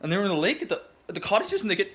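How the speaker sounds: ordinary delivery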